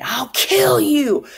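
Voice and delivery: put-on high, loud and slow, like a boy in a frenzy; frenzied threat. Teacher voicing Richard's frenzied threat to the gang. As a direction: draw out a wild threat. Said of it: "at one point he said".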